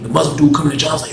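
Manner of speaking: normal talking voice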